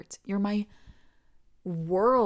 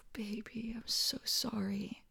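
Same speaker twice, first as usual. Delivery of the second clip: sigh, then a much softer whisper; apologetic whisper; 3 semitones higher, 7 dB quieter than usual. Acted. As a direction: whisper the apology close and gently.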